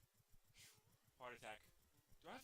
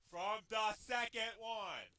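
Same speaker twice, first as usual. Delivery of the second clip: "From the second one" drawn out word by word; slow, deliberate self-reminder; slow emphatic repeat; slower than usual. Real, not acted. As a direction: stretch each word to drive it home.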